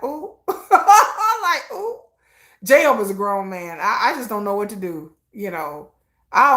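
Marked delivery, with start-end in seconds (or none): 0.0-2.0 laughing ooh. Retold or imitated. Reacting to [name] interview.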